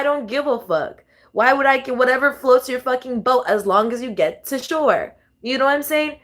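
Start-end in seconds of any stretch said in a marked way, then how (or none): none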